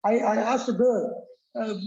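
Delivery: usual speaking voice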